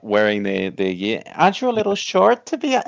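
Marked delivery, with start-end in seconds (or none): 1.3-2.9 higher-pitched mock character voice; film quote impression. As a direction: lift the pitch to mimic a puzzled movie line.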